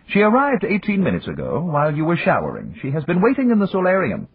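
high, prim servant voice; a dutiful mechanical butler; polite butler robot. Acted. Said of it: "The butler robot trundled up".

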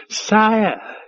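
high yelped call of a nervous servant; yelping waiter. A narrator's reading. Waiter skittering up to Five Tide, yelping. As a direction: yelp it out, pitched high. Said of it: "yelped the eunuch juvenile waiter".

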